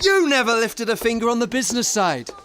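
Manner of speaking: high, loud angry retort; heated retort. Acted. Jerry hits back at Ewan mid-row, angry. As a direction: snap back at him in the quarrel.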